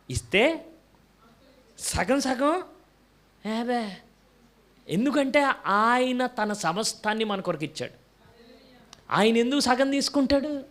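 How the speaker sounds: high, ringing pulpit voice; preacher driving the point home to the congregation; fervent preaching. Acted. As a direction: preach it out at full force. Preaching climax.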